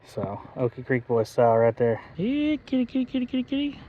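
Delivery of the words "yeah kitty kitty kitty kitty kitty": calling kitty. Real, not acted. Calling the catfish softly.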